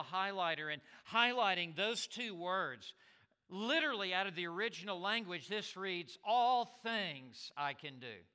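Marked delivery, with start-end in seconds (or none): 6.2-8.2 "All" hit hard, the line slow; stress on ALL. Shown as a demonstration. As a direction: lean on "All" and draw it out. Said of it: "the sentence begins, "all things".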